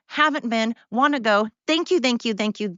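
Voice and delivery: ordinary talk, nothing marked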